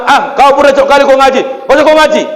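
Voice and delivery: put-on a mother scolding her kid loudly. (ibu memarahi anaknya dengan suara keras)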